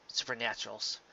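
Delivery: usual speaking voice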